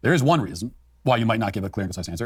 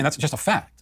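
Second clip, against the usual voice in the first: ordinary talk, nothing marked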